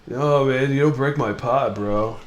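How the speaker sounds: stoned dude defending his pot; stoner voice. Retold or imitated. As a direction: play an offended pothead, bro. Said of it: "all the stoners out there are like".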